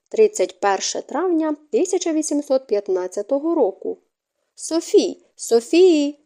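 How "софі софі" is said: high, understated shout for someone; frantic calling out. A narrator's reading. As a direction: call out sharply, voice raised in pitch. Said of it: "Від цих несамовитих криків".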